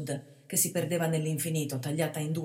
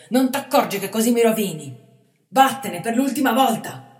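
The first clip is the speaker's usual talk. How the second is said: loud, higher furious command; 5 semitones higher, 11 dB louder than usual. A narrator's reading. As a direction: order him off in rage. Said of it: "ripeté furioso Planetta".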